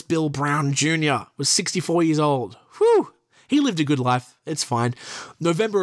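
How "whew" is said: sharp high whew of relief; whistled whew. Real, not acted. Reading about a sixty-four-year-old victim, a loud high whew.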